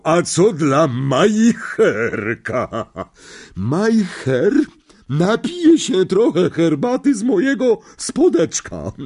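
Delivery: put-on high, loud, overbearing old aunt; a big domineering aunt who loves her dog; bossy aunt. A narrator's reading. Voices Aunt Marge. (władcza ciotka, wysoki głos)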